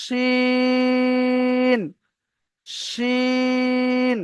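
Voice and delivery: শিন with a thick শি held long; heavy শ, drawn-out vowel; long shin. Shown as a demonstration. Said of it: "আমারটা ভালো করে মন দিয়ে শোনেন". (মোটা শ টেনে শিন)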